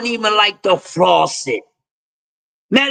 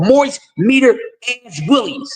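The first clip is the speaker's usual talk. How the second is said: ordinary talk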